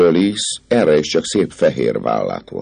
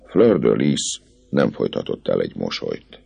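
ordinary delivery, nothing marked

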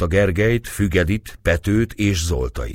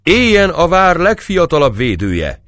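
high, loud cheering cry; Pető toasting the youngest defender; shouted toast; 12 semitones higher, 6 dB louder than usual. Acted. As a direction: call it out over the whole table. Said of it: "kiáltotta Pető".

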